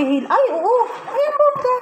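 very high squealing wow; amazed gushing. Retold or imitated. Retelling the TikTok video, she gushes in a squealing, very high voice. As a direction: gush like the amazed TikTok user. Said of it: "Tapos sabi".

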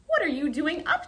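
high, loud startled question; a startled turkey calling out; surprised turkey. Acted. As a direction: catch him out, taken aback. Turkey startled to find Groundhog.

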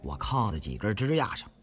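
plain reading voice

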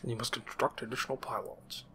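game advisor voice. Retold or imitated. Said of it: "the soundtrack to my childhood".

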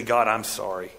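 low, soft repentant prayer; hushed confession. Acted. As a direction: murmur it like a broken apology. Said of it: "run to say".

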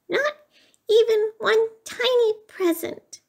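high, pleading little squeak; squeaking mouse. A narrator's reading. Mouse pleads from the closet, high squeak. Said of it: "squeaked Mouse".

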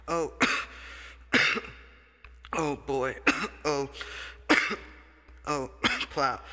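words broken up by loud coughs; coughing fit. Acted. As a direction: choke out each word between coughs.

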